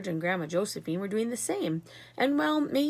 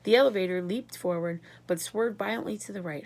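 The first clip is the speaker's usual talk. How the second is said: ordinary delivery, nothing marked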